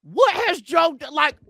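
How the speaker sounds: ordinary delivery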